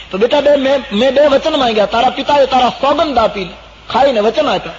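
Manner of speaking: high, loud insistent mother; forceful declaration. Acted. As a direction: announce the demand emphatically. Kaikeyi forcefully declares her two boons.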